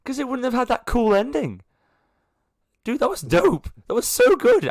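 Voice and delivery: high, loud thrilled gushing; excited protest. Real, not acted. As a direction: gush over the cool ending. GM excited about cool ending.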